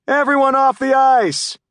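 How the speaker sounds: loud, high coach's holler; shouted order. A narrator's reading. Coach clearing skaters off the ice, shouted order. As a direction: holler it across the rink.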